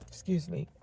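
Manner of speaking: ordinary talk, nothing marked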